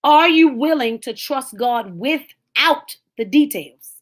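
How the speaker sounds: unhurried, deliberate question; slow challenge. Real, not acted. Preacher to congregation, slow challenge. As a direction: lean in and slow down.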